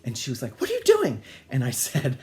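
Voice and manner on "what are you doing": high, loud retold demand; mock-indignant mother. Mother to child locked in bathroom, mock-indignant. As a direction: imitate a mother yelling through a door.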